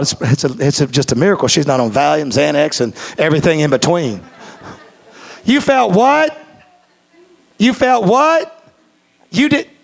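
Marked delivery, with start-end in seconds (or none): 5.4-9.7 incredulous wife. Retold or imitated. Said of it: "she tells me".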